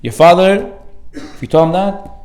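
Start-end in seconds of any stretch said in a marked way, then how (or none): none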